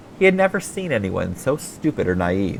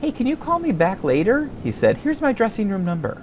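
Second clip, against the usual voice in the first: put-on higher, boyish and friendly voice; young star voice; 13 semitones higher than usual. Thirteen-year-old TV star chatting with a fan, higher boyish voice.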